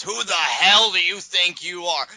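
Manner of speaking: slow, high, loud furious demand; imitating an irate Stephanie, slow and loud. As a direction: bark it at him like an irate boss. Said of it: "Stephanie is irate".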